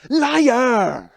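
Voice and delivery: high, drawn-out loud accusation; in-your-face yell. Retold or imitated. Older brother to little brother, loud accusing shout. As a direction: yell it right into his face. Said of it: "Right in his face".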